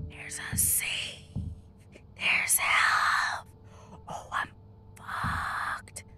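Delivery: slow, breath-only whisper with gasps; excited whisper. Real, not acted. Player finding a safe and supplies, excited whisper. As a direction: whisper in awe at what you have found. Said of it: "I don't know why I'm whispering so much".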